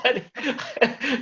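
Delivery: bursting into high laughter; real delighted laugh. Real, not acted. Host keeps laughing.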